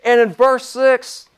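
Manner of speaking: high, forceful preaching; fired-up preaching. Real, not acted. Preacher urging the saints to get radical, fired-up preaching.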